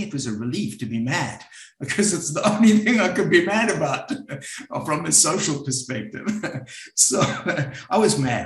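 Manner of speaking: a rueful laugh at himself. Real, not acted. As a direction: chuckle through the confession. Laughs at own admission.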